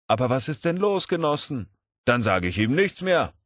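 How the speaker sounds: high-pitched complaint to the comrades; aggrieved protest. Acted. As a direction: protest as the wronged lecturer. Puskow beschwert sich bei den Genossen. (gekränkter, hoher Protest)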